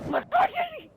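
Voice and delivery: very high loud outburst; shriek. Real, not acted. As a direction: shriek in frustration.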